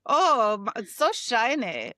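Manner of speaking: a light hint of a camp German man, gushing; lightly voiced German man cooing over shiny nails.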